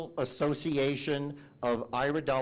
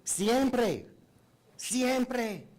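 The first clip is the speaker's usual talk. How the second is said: high emphatic exclamation; 8 semitones higher than usual. Real, not acted.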